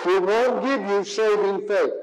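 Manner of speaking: usual speaking voice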